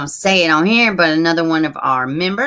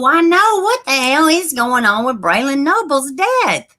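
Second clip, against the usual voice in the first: high, loud, disbelieving cry; upset outcry; 9 semitones higher, 4 dB louder than usual. Real, not acted. Reacting to the news of Braylon Noble's death, a high and loud cry of dismay.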